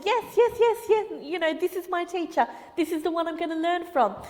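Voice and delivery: put-on high, thrilled kid gushing fast; a boy bubbling over with excitement; excited boy. Boy gushing to his new karate teacher, high and excited. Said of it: "the boy was super excited".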